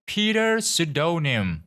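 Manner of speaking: high, slow falling repeat of a name; nurse calling, final. A narrator's reading. Nurse repeats the name before giving up, high slow falling call.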